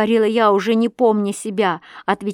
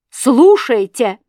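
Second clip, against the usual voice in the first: loud, drawn-out bellow; insistent shout; 4 semitones higher, 9 dB louder, much slower than usual. A narrator's reading. Husband shouts over the girl, insistent shout. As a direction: shout over her, stretched out.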